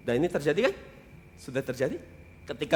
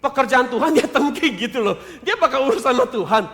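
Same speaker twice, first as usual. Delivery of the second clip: laughing; 9 semitones higher, 9 dB louder than usual. Real, not acted. Laughing at the rival pastor.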